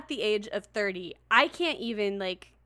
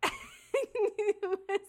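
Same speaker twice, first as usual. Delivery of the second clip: laughing; 10 semitones higher, much slower than usual. Real, not acted. Laughing recalling the bad audiobook.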